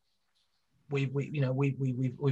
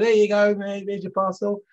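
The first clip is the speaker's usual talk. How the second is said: cheery, louder, higher postman greeting; 6 semitones higher, 5 dB louder than usual. Retold or imitated. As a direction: bellow it out like a chirpy delivery man. Postman voiced in TV story.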